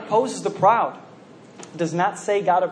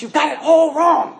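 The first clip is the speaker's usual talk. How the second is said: stifled voice straining up higher; strangled inner voice; 7 semitones higher, 4 dB louder than usual. Acted. Humility being strangled by pride, straining to be heard. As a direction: push a choked warning out, rising in pitch. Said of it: "suffocating it into an intense whisper at best".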